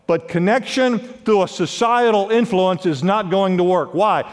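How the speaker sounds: ordinary talk, nothing marked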